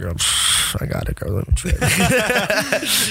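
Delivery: breathy cocky shrug, then a slow high tease; mock-confident boast to a friend; cocky brag. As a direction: brush it off like it was nothing.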